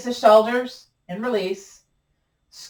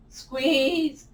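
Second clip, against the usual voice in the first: voice cracking up an octave; 5 semitones higher, 4 dB quieter than usual. Real, not acted. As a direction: voice cracks mid word. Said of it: "My voice cracked".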